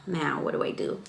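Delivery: low, soft, helpless mutter; stressed-out. Retold or imitated. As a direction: sigh it out quietly, at a loss. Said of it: "It was stressful to say the least".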